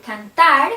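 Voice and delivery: cantar, stressed on "tar", as a teacher's demo; stress on final syllable. Shown as a demonstration. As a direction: say cantar leaning hard on the ending "ar". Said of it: "the stress is in ar".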